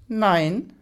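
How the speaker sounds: brusque commanding no; curt no. A narrator's reading. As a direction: snap a firm no. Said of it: "ein schroffes".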